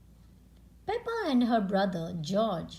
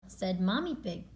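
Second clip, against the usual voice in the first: usual speaking voice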